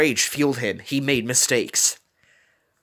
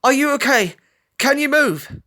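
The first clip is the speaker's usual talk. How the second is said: anxious; 14 semitones higher, 5 dB louder than usual. A narrator's reading. Scarlet checking the injured falcon.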